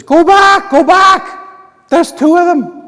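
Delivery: very high, loud falsetto scream; panicked yell. Acted. Wounded English soldier warning his army, panicked falsetto yell. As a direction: scream the warning at full pitch.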